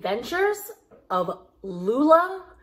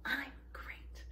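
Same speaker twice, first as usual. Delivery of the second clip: whispered reply; 5 semitones higher, 15 dB quieter than usual. Shown as a demonstration. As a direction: whisper the answer, no voice. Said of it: "I want you to respond by saying".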